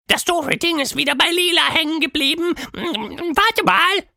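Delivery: sehr hohe, quirlige Monsterstimme; Quatschmonster, genervt. A narrator's reading. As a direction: ein ulkiges Monster ärgert sich über sein Licht. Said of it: "sagte Quatschmonster". (Quatschmonster schimpft über die Farbe, sehr hoch)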